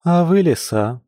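a touch of perplexity; puzzled. A narrator's reading. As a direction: ask in mild bewilderment. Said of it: "в недоумении пожала плечами Маша".

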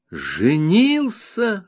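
high, slow, delighted "Женился!"; joyful exclamation. A narrator's reading. Narrator hears his friend married, joyful slow exclamation. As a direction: savour the happy news. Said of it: "радостно воскликнул я".

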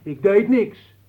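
high, loud protest; shouted denial. Acted. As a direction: cry out in self-defence. Denies again when pressed to confess.

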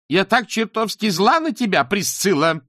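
furious shout. A narrator's reading. Beryl shouts at her daughter in fury. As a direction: yell it at her in full rage. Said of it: "в ярости крикнула Берил".

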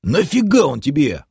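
high, exasperated question; mildly outraged challenge; indignant. A narrator's reading. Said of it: "возмутился гном".

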